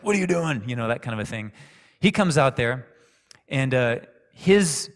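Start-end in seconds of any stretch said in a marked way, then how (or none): 0.0-0.6 higher, louder challenging demand; imitating a pushy teacher; in-your-face confrontation. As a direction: get in his face with it. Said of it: "he's more in your face, like".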